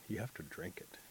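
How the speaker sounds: hushed secret whisper. Acted. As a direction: breathe it like a secret. Mole reveals the secret.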